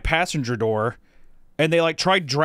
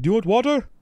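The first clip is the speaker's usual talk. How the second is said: 1 mimicking helper; 10 semitones higher, 4 dB louder than usual. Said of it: "And they're like".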